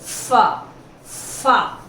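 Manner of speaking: फा with the teeth on the lip, like f; demo sound. Shown as a demonstration. Teacher showing the dotted f sound. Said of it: "तो देखिए".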